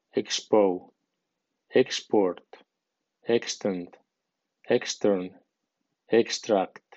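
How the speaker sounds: "excerpt", "exile", "export" stressed on the first syllable. Shown as a demonstration. Teacher lists cases where EX takes strong stress, EX-port. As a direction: hit EX hard in "exit", "expert", "extract". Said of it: "E X lleve acento fuerte sonando".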